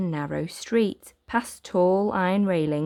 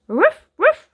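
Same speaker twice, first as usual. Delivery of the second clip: very high, loud yips; yappy barks; 10 dB louder than usual. Acted. The little dog yapping excitedly at the children. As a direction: yip twice like a tiny dog. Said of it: "barked Patch".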